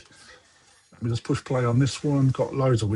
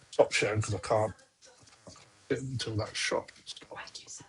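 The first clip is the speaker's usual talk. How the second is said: muttered under breath; 4 semitones lower, 11 dB quieter than usual. Real, not acted. Muttering to self at screen.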